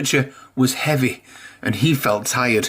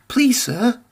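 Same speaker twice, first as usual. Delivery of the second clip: much higher, pleading and keen; eager plea; 10 semitones higher than usual. A narrator's reading. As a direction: beg like kids desperate to be picked. Engines eagerly begging to go.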